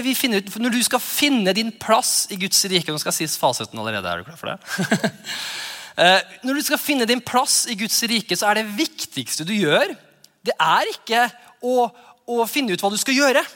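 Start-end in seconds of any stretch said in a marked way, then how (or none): none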